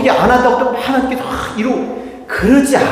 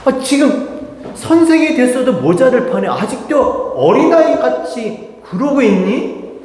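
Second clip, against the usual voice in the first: raised-pitch mock reproach; exasperated scolding; 6 semitones higher than usual. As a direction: act out chiding a grown pupil. Acted scolding of slow learners. (한심하다는 듯 높은 목소리로 나무라는 흉내)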